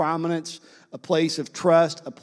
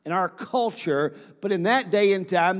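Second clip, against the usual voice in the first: normal talking voice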